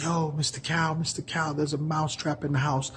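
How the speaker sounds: high, frantic mouse begging the cow; a tiny mouse desperate for help; pleading mouse. Acted. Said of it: "was like".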